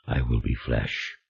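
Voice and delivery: low, soft and slow, timid; a demon barely daring to say it. A narrator's reading. The demon answers Julien in a low, soft, timid voice. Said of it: "in a timid voice he said".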